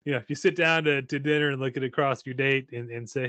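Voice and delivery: ordinary delivery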